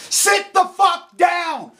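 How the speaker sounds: sit the fuck down, dragged out slowly; each word slow and heavy, seething; slow angry order. Real, not acted. Ranting at anti-nukers, a slow seething command.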